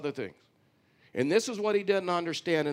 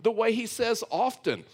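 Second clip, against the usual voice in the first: higher-pitched retold challenge; wife's retort; 7 semitones higher than usual. Preacher voices his wife questioning his mockery, higher-pitched. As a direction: challenge him like a baffled wife. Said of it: "And she goes".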